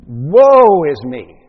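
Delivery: loud, drawn-out cry of woe; Isaiah's woeful cry. Acted. Preacher voicing the prophet's response to the scene, loud woeful cry. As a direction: wail it out slowly at full volume. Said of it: "Isaiah's response to seeing this magnificent scene".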